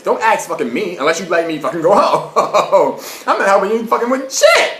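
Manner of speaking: laughing rant at the detective; laughing mock rant. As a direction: tell him off through laughter.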